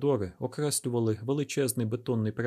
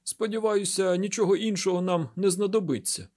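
markedly high-pitched dialogue line; raised character voice, high throughout; 8 semitones higher, 4 dB louder than usual. A narrator's reading. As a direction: pitch it far above the narration.

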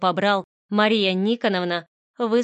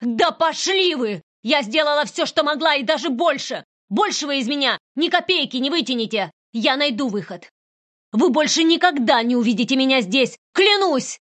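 higher, louder raging shout; furious outburst; 6 semitones higher, 5 dB louder than usual. Acted. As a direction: hurl every word at her as you leave. Heroine explodes and quits. (яростный крик)

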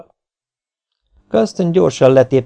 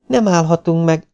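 alarmed; 7 semitones higher than usual. A narrator's reading. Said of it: "mondta riadtan Julie".

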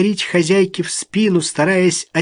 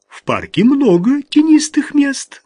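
snide; 11 semitones higher, 4 dB louder than usual. A narrator's reading. Alma's mocking retort.

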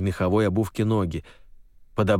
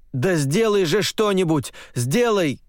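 desperate shout; 10 semitones higher than usual. Acted. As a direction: yell it in panic. Desperate plea in a dream.

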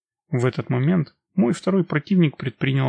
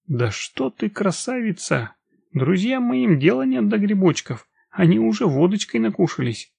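plain reading voice

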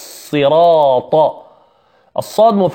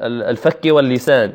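ordinary delivery

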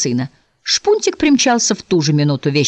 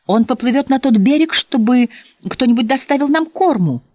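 higher-pitched proud explanation; 8 semitones higher than usual. A narrator's reading. Mother explains her son's mission to the Squire, proud. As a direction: announce it proudly, voice lifted. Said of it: "гордо ответила миссис Барлилав".

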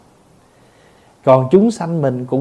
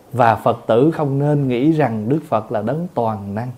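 ordinary talk, nothing marked

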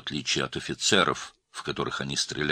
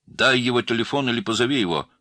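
higher, insistent command; almost ordering; 7 semitones higher, 5 dB louder than usual. A narrator's reading. Girl demanding him on the phone, commanding. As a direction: press it like an order. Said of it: "почти приказала девушка".

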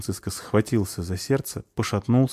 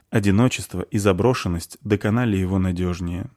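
plain reading voice

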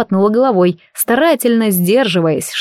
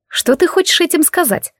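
high-pitched, wary demand to explain; frowning question; 8 semitones higher than usual. A narrator's reading. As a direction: ask with a frown, pitch lifted well up. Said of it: "нахмурилась я". (настороженно, нахмурившись)

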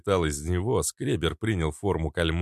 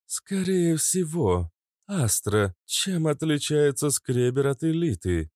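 female character voice; 8 semitones higher, slower than usual. Acted. Kobra character speaks.

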